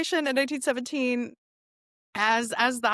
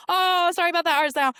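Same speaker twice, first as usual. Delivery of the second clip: breezy fake apology, higher and louder; mock apology; 6 semitones higher, 5 dB louder than usual. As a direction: play a cheerfully unapologetic grabber. Mimics Britain grabbing land, not sorry.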